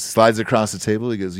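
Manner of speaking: ordinary delivery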